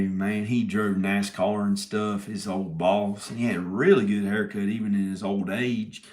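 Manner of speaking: ordinary delivery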